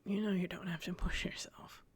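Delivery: soft, whispered reassurance; quiet, caring near-whisper; hushed concern. Acted. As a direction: say it gently under your breath. Son gently comforts his mother.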